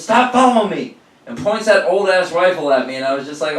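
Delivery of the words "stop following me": high, loud mock robber yell. Masked robber yelling at the cashier following him. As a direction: snap at him to back off.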